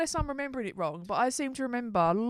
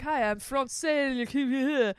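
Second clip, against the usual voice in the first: very slow mock French greeting; French-Cockney voice; 2 semitones higher, 4 dB louder, much slower than usual. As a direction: drawl it like a fake Frenchman. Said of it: "He's like".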